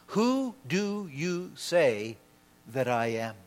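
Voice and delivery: word by word, sinking low and soft at the end; slow, solemn question. Acted. Preacher putting Christ's question to the congregation, slow and solemn. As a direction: space out each word and let it settle.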